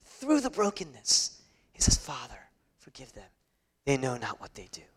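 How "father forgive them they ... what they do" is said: very soft, low, fading to a whisper; quiet, broken-off plea to a father; hushed dying prayer. Retold or imitated. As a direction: pray it faintly, almost without voice. Voicing Jesus's dying prayer.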